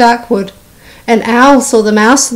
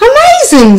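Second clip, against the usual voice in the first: very high astonished squeal; amazed; 4 dB louder than usual. Acted. Gruffalo marvelling at the mouse, amazed. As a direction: marvel at it out loud.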